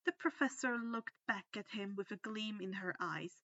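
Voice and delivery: ordinary delivery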